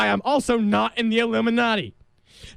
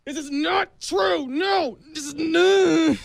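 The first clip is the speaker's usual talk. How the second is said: higher, hammed-up denial; mock protest; 6 semitones higher, slower than usual. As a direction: protest too much, higher. Denying loudly, higher.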